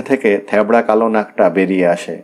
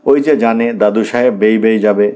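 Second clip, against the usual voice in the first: ordinary delivery, nothing marked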